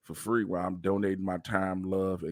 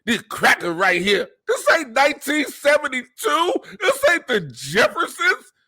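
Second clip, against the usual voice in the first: much higher, louder, laughing caricature; mocking old-school voice; 7 dB louder than usual. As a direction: ham up a dated sitcom line.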